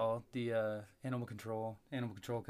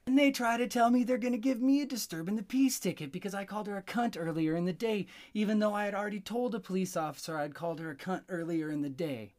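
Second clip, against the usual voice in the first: higher, louder, fast indignant rant; real indignation, louder and higher; outraged; 7 semitones higher, 4 dB louder, faster than usual. Real, not acted. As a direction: blow up at the absurdity of it. Outraged about police ticket.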